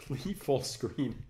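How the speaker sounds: ordinary talk, nothing marked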